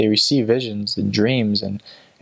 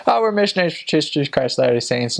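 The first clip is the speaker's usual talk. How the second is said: a bit higher and quick; quoted reply; 5 semitones higher, faster than usual. Retold or imitated. Missionary to taxi passengers, quick slightly higher reply. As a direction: rattle off who you are.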